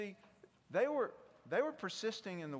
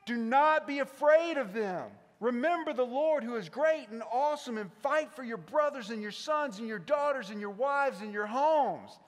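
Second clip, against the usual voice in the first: raised, ringing rallying speech; higher and louder, rallying troops before a battle; Braveheart-style battle speech; 4 semitones higher, 6 dB louder than usual. Acted. Leader rallies the people to fight, big rousing speech. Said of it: "it's the big moment, the big speech".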